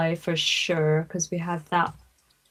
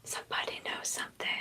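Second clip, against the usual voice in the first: conspiratorial whisper; 16 dB quieter than usual. Real, not acted. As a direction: whisper it like a secret tip. Conspiratorial aside about big order.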